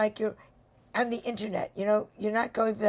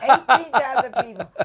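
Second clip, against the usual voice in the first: laughing disbelief; 8 dB louder than usual. Real, not acted. Host repeats the number, laughing.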